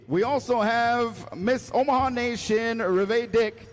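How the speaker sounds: powwow emcee. Acted. Powwow emcee introducing honor guard.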